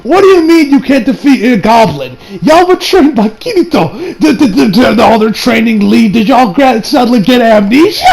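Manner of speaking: high, stammering comic outrage; incredulous questioning. Acted. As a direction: sputter questions in disbelief. Acted incredulous questioning of characters.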